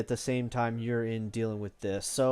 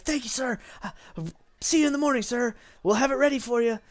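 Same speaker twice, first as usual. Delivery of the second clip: high, bowing and flustered; blustering boy; 10 semitones higher, faster than usual. Acted. Boy bowing to the customer, high and flustered. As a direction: squeak out thanks, much higher. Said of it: "blustering and, he's red in the face".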